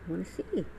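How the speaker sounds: ordinary talk, nothing marked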